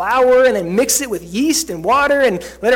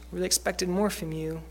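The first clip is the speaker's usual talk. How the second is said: disappointed; 2 semitones lower, 8 dB quieter than usual. Retold or imitated.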